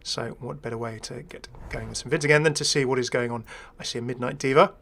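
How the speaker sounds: ordinary talk, nothing marked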